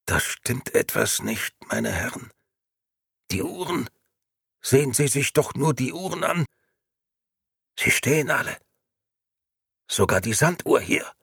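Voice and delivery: a colder, greyer voice sensing danger; a hint of a sinister grey gentleman noticing something wrong; ashen grey gentleman. A narrator's reading. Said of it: "seine Stimme klang noch eine Spur aschenfarbener".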